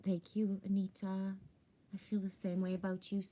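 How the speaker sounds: ordinary talk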